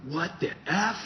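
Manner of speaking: high, loud mock outrage with laughter; laughing impression of a shocked man; shocked outburst. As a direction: play the stunned building manager. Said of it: "and Stephen went".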